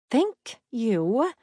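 clipped, impatient thank-you. Acted. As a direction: grind it out with impatience. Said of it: "she ground out impatiently".

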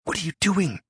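urgent whisper. A narrator's reading. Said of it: "Eric hissed urgently at his side".

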